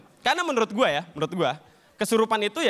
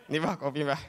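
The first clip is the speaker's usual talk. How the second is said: meek helper; 9 semitones lower than usual. Acted. Helper offering coffee to the spirit, low coaxing.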